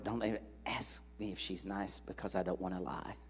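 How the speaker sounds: hushed, desperate whisper to God; whispered prayer. Retold or imitated. Praying silently while the grandfather asks about Edna, whisper. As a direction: pray it under your breath so he won't hear.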